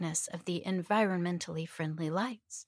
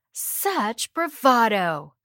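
mocking; 4 semitones higher, 9 dB louder, much slower than usual. Mocking captive's bravado.

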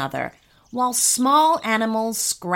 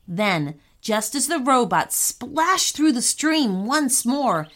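usual speaking voice

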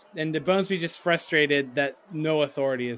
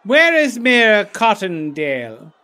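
put-on lightly accented character voice, drawn out; mildly hammed-up indignation; indignant De Bonesby; 5 semitones higher, 10 dB louder than usual. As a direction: demand the mayor. Player acts De Bonesby.

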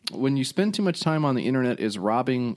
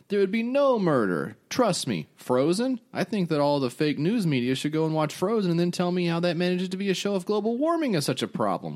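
high, blustery cartoon politician; cartoon Trump voice; 8 semitones higher than usual. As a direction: puff it up like a bragging cartoon president. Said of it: "this is in the voice of a cartoon Trump".

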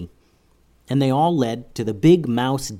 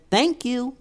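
very high little mouse chorus; tiny squeaky critter; 12 semitones higher than usual. Acted. Mice thank together.

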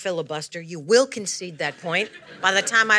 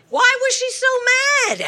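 baffled; 4 dB louder than usual. Pretend. Rereads her note, baffled.

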